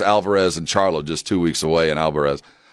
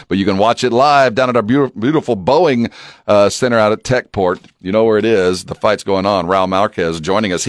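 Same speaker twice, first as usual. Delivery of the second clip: booming prize announcer; 4 dB louder than usual. Acted. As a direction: boom it out like a morning show host. Booming show intro over music.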